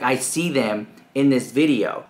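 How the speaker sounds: ordinary talk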